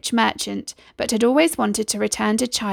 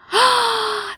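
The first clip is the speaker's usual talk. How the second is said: high, thrilled mother's gasp; delighted gasp; 12 semitones higher than usual. A narrator's reading. Mother hears her son will be a merchant, high delighted gasp. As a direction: gasp with joy as a hopeful mother. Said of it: "said Aladdin's mother".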